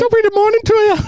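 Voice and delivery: very high, comic Irish lilt; leprechaun Irish accent. Pretend. Answering co-host's greeting as a leprechaun, squeaky Irish accent. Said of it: "leprechaun dick vibes".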